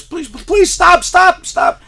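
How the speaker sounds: very high, loud begging scream; screaming plea. Retold or imitated. Boy screaming at the teacher to stop, very high and loud. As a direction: shriek stop over and over. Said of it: "and I was".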